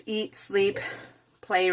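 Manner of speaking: ordinary talk, nothing marked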